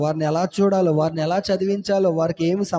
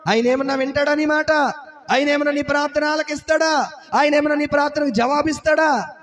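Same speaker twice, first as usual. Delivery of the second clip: angry rebuke; 7 semitones higher than usual. Retold or imitated. Bystanders silencing the shouting beggar, high scornful scolding. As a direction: tell him off harshly, scornful.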